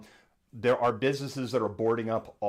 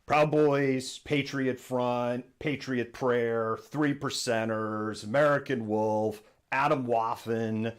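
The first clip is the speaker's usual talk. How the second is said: slow listing; slower than usual. Real, not acted. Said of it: "lists groups slowly".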